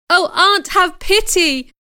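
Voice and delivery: high, desperate plea; desperate cry. A narrator's reading. Child begs aunt for pity, crying desperately. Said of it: "I cried desperately".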